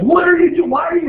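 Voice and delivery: put-on high, loud, bewildered kid questions; a mock schoolkid asking the teacher what she is doing; imitating puzzled kids. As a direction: mimic the students squeaking in confusion. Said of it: "'Cause they're like".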